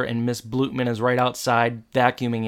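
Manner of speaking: ordinary delivery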